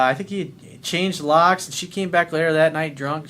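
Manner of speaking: usual speaking voice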